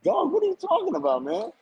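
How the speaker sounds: high, squeaky disbelief; incredulous disbelief. Retold or imitated. Retelling his reply to the angry fan, high incredulous squeak. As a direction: pitch way up in mock bewilderment. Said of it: "I'm like".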